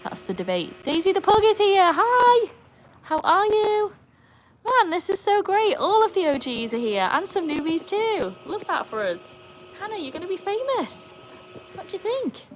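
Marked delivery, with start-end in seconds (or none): 0.8-7.1 high, loud, delighted welcome; excited greeting. Real, not acted. Streamer welcomes Daisy the Pug, excited and high. 9.8-12.4 high-pitched cooing to a dog; pet talk. Real, not acted. Asking Hannah about being famous, high pet talk.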